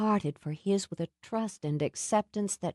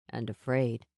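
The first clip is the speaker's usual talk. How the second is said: low gentle Sam; 7 semitones lower than usual. A narrator's reading. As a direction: lower voice, tender. Sam noticing her fear.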